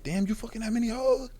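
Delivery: high-pitched incredulous question; amazed younger guy. Acted. Acting out impressed younger self.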